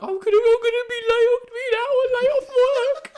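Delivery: very high, loud hammed-up wail; mock crying. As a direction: imitate a hysterical sobbing meltdown at full pitch. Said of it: "She was crying because she".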